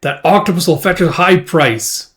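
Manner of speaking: rally the crew to kill, loud and greedy; greedy sailor shouting. Acted. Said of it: "It's like".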